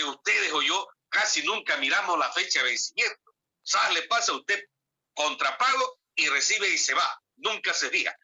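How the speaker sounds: ordinary delivery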